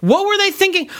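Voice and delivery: exasperated. Real, not acted. Exasperated rant about gun.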